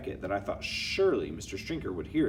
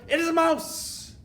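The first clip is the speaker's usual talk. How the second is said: high-pitched, loud witch's climax; theatrical, high exclamation; triumphant reveal; 6 dB louder than usual. Acted. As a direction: cry it out as the grand reveal.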